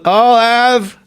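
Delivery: high, drawn-out drive-thru order; Chris Farley. Retold or imitated. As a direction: lightly imitate a big eater starting an order. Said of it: "Farley pulls up to a drive-thru window and he goes".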